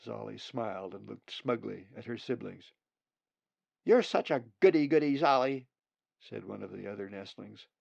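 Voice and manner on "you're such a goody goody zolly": higher, louder mocking sibling voice; teasing nestling. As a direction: tease your know-it-all sister. Sibling teasing the smart one.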